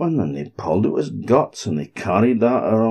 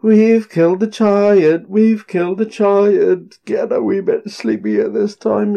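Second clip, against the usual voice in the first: tired cheering; 12 semitones higher, 4 dB louder than usual. A narrator's reading. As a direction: cheer while worn out. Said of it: "they said".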